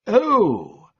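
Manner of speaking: the penny drops; sudden realisation. Acted. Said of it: "with sudden enlightenment".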